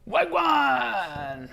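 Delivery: high, bright shout of the greeting; excited exclamation. Acted. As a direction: call it out like a greeting.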